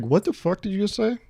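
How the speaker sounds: imitating his own outraged challenge, pitched high; mock outrage. Retelling how he confronted his friend, in mock outrage. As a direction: play up the fake shock.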